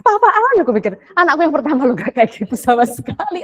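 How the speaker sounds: disbelief. Retold or imitated. Mother shocked by child's tantrum.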